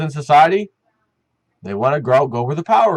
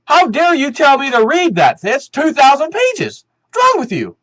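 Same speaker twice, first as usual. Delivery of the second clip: higher, louder indignant squawk; outraged old senator, hammed up; mock indignant congressman; 8 semitones higher, 3 dB louder than usual. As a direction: play the offended old politician. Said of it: "He was upset".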